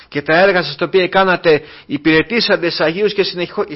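ordinary delivery

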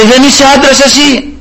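high-pitched mock call, no real shout; retold calling out. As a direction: imitate a challenge called from a distance. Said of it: "άρχισε να φωνάζει ο Δαβίδ". (σαν να φωνάζει από μακριά, ψηλά)

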